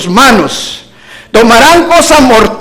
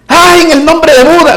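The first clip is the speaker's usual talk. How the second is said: mock cry to Buda; 6 semitones higher, 3 dB louder than usual. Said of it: "usted no oye que la gente dice".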